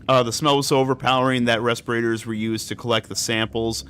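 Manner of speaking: low, even document read; reading aloud. Acted. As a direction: read the report out calmly. Reads in-game document aloud.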